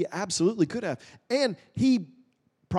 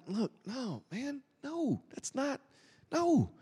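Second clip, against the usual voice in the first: soft firm refusal; 6 semitones higher, 7 dB quieter, slower than usual. Retold or imitated. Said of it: "Jesus is like".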